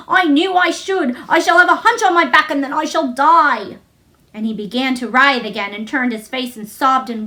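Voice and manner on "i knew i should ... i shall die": choked despair. A narrator's reading. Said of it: "choked out Colin".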